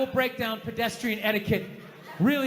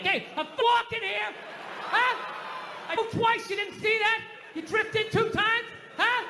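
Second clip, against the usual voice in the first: De Niro tough guy; 12 semitones higher than usual. Retold or imitated. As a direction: play the affronted New Yorker yelling back. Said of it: "go like old school Dustin Hoffman, De Niro".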